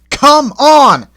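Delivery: high, loud straining shout; effortful call. A narrator's reading. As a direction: heave and shout. Toby heaving the coaches.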